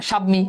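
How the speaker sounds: "স্বামী" as shab-mi, with the silent ব sounded; spelling pronunciation. Shown as a demonstration. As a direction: say "স্বামী" with a clear b before the m. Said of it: "এমনই তো হওয়ার কথা".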